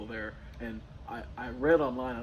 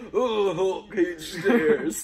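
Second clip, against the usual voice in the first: very slow, louder, laughing; laughing mock quote; 5 semitones higher, 9 dB louder, much slower than usual. Friend quoting the Hulk-stairs joke, laughing slowly. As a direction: drag it out through laughter.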